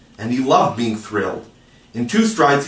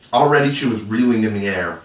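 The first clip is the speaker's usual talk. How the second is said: ordinary delivery, nothing marked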